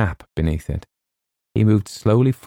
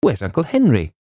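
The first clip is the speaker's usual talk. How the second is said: higher-pitched, cheerful question; lilting query; 7 semitones higher than usual. A narrator's reading. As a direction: call it out lightly and gaily. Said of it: "she called gaily".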